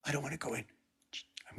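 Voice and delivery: soft, voiceless whisper. Pretend. Sneaking toward the hallway in the picture, whispering. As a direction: hush it like you're hiding.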